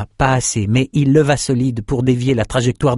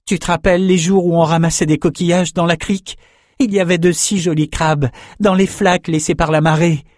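higher-pitched, earnest reminiscing; pleading young fighter; 7 semitones higher than usual. A narrator's reading. Swordsman reminds his friend of childhood, earnest pleading. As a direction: coax him with memories in a raised, insistent voice.

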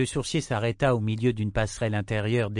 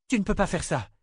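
pleading; 9 semitones higher, faster than usual. Acted. Wife pleading with husband.

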